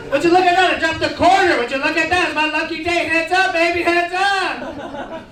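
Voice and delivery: high-pitched fake nonchalance; playing it off. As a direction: cover the emergency with chirpy small talk. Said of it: "you gotta play it off".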